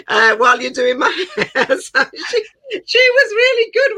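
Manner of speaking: high voice breaking into real laughter; laughing while talking. Real, not acted. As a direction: talk through helpless giggles, pitch climbing. Laughing while recalling the lessons.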